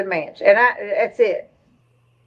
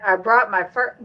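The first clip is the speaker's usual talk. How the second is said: normal talking voice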